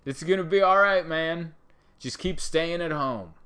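mock celebrity vlog. Mocking celebrity vlogs telling fans to stay home, hammed-up. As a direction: parody a star soothing fans from a mansion. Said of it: "celebrity vlog style video where they go".

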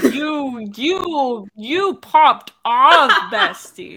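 slow, loud, stretched out hype; drawn-out loud cheer; excited praise. Real, not acted. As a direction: hype up your friend. Praising co-host's acting.

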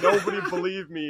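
acted indignant triumph; vindicated outburst. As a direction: protest as the man nobody trusted. Vindicated passenger in retold climax.

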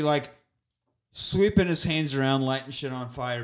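ordinary talk

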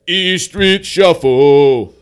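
slow, loud, booming backup line; deep backing vocal. Retold or imitated. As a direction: stretch it out like a backing singer.

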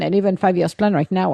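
ordinary talk

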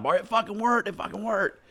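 mock elated drunk; gleeful. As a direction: celebrate the scam working. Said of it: "They're high-fiving outside".

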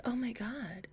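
unvoiced, under the breath; exasperated whisper. Real, not acted. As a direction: whisper it to yourself in disbelief. Exasperated aside over the name.

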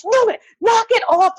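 high-pitched exasperated yell; retold angry shout. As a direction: yell at the kids to stop, acting it out. Acts out yelling at jump-scaring kids.

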